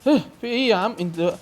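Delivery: loud, high-pitched alarm; panicked protest. Real, not acted. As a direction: protest loudly as the clown attacks. Player attacked, protesting in panic. (اعتراض بصوت مفزوع)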